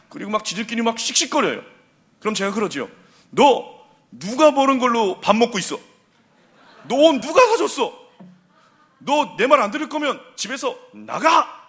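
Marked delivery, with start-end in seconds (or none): 3.3-11.6 loud and higher, a parent's furious scolding; loud angry dad chewing out his kids; angry father yelling. Retold or imitated. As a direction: blast the kids like a fed-up dad.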